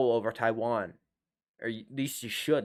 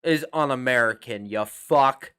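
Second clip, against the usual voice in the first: louder emphatic declaration; 6 dB louder, slower than usual. Acted. Emphatic mock-patriotic declaration.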